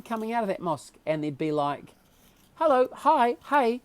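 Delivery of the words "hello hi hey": high, loud bright hello to a passer-by; imitating friendly neighbours calling hello; cheerful greeting. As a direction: greet him like an old friend. Mosque worshippers greeting him warmly.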